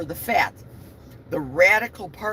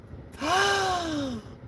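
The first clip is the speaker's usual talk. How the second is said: sharp gasp; 12 semitones higher than usual. Real, not acted. Sudden gasp.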